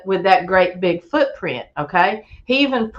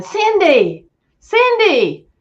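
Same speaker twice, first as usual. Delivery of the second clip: high called-out name, rising further on the second; hollering a name; 10 semitones higher, 3 dB louder, much slower than usual. Retold or imitated. Retelling hollering at his sister during play, high calling. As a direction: call her name across the campsite. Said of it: "hollering at her".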